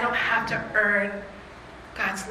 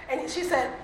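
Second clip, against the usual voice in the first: ordinary talk, nothing marked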